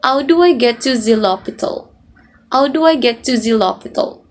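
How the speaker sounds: demonstrated French English, H dropped; French accent, "to zee lopital". Shown as a demonstration. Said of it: "if you want the French accent".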